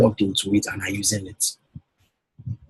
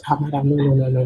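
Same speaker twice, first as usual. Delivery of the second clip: client's coy denial; 5 semitones higher, faster than usual. Acted. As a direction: play the flattered woman modestly waving it off. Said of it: "When my wife sees me talking like this".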